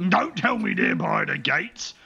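high, drawn-out ogre voice; accusing ogre. Acted. Ogre rejecting the captive's lie, slow accusing voice. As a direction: accuse slowly as a dim ogre. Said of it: "and he goes".

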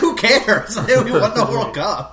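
loud, high, laughing brush-off; carefree boast. Pretend. Imagined fan shrugging off critics of a boring World Cup win, loud laughing boast. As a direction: toss it off like a giddy winner.

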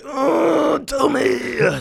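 high squeaky pleading "oh, tell me"; mock eager listener. To the listeners, a high mock-begging fan voice. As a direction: play a desperate fan begging to hear. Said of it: "what everybody's so just like".

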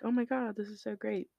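usual speaking voice

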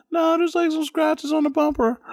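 high, upset voice; high, upset imitation of a crying girlfriend; panicked girlfriend. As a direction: voice a hysterical pregnant partner. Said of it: "She's like".

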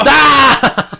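loud, high yelp of mock horror; piercingly high mock scream; hammed-up scream. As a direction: scream at an awful reveal. Horrified reaction to the reveal.

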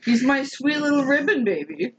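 put-on pet baby talk. Doting on the cat Boy, cooing baby talk. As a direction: gush over a beloved pet.

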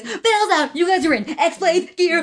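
hammed-up high announcement; Cokie McSnorthead. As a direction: act out a character's big pitch, drawn out. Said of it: "he literally says the lines like this".

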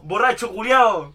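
loud, drawn-out heckle; mock insult shout. Podcast guest cursing a TV host, mock outraged shout. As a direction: hurl it out slow, loud and long.

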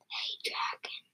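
barely audible whisper; very soft whisper. A narrator's reading. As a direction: whisper it very softly to a sleeper. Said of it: "whispered very softly".

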